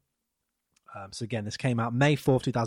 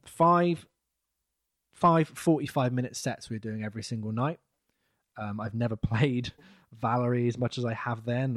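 ordinary talk, nothing marked

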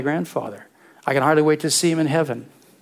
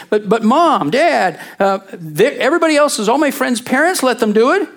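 put-on higher, pleading kid's whine; whiny teenager; 8 semitones higher, 4 dB louder than usual. Teen begging parents to let them go, whiny. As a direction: beg like a teen wanting permission. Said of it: "young people come home and say".

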